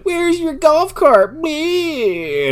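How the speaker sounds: high, drawn-out British heckle; coked-out British guy. Retold or imitated. British guy needling the caddy as he walks by, high mocking call. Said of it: "this coked out British guy".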